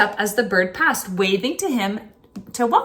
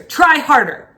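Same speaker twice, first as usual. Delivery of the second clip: louder, higher command; harsh order; 5 semitones higher, 8 dB louder than usual. Acted. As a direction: bark it down at the stork. Officer ordering the stork.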